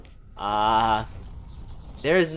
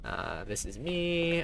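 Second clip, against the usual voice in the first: ordinary talk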